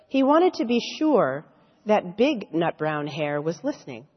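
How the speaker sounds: lively storybook voice with character voices; reading to children. Acted. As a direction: read it like a bedtime story to little kids. Reading picture book to children.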